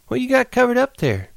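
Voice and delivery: Curly's wife, teasing. Acted. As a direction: tease him like a flirt.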